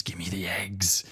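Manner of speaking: breathy eerie whisper demand; creepy whisper. Acted. Mum hissing for the eggs in a creepy whisper.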